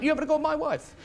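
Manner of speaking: a hint of a threatening husband; mock jealous husband. The jealous husband confronting the comic, lightly mocked. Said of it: "and he went".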